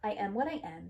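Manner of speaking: ordinary delivery, nothing marked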